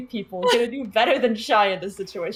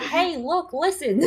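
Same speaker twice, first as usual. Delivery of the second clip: imitating Brooks; 4 semitones higher, slower than usual. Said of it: "Brooks doing that".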